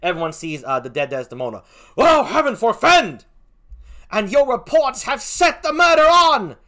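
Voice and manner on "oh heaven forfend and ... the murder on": high, loud stage horror; horrified outcry. Acted. Onlookers hear of the murder, high, loud and appalled. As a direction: cry out the accusation.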